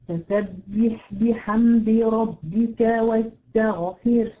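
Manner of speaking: slow Quran recitation, each letter distinct; slow careful recitation. Shown as a demonstration. The teacher recites the verse correctly for her student. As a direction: recite واستغفر slowly with a clear ghain. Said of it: "خطأ شائع جدًا".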